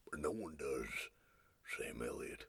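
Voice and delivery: low, whispered cowboy rumble; Sam Elliott impression. Host trying out his Sam Elliott, low whispered. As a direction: hush it like a gruff old cowboy. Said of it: "That doesn't sound like Sam Elliott".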